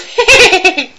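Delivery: high, loud ghoulish cackle; Crypt Keeper cackle. Retold or imitated. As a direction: cackle like a horror host. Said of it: "the fucking Crypt Keeper".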